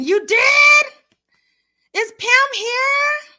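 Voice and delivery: excited squeal. Real, not acted. Hearing Pam is in the chat, high excited squeal. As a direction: squeal with delight at the news.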